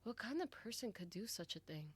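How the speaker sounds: usual speaking voice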